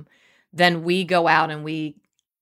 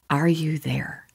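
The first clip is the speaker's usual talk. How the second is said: whispered check-in; hushed whisper. Real, not acted. Host asks listeners if they are following, whispered.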